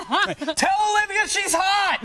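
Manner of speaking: high, slow hammed-up yell; mock caller yell. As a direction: play a fan shouting into a phone. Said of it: "calling the machine and yelling loudly at it".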